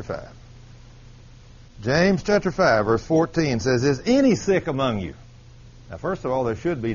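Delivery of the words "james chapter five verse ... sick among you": preaching scripture. Acted. Preacher reading James to the church, full force. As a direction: proclaim the verse to the congregation.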